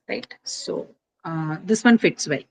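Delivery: ordinary delivery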